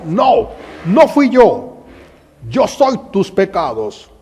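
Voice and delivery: high, loud, slow and otherworldly denial; soldier's reveal. Acted. The soldier revealing himself as her sins, loud and slow. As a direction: say it like a revelation.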